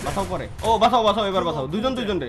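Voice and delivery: ordinary talk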